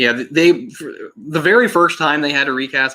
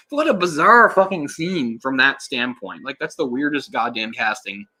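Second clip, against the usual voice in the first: ordinary delivery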